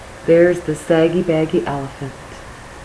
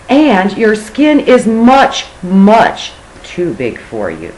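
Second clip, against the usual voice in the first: drawn-out much, much; slow emphasis; 4 semitones higher, 4 dB louder, slower than usual. Acted. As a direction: stretch it out slowly. Dragged out for emphasis.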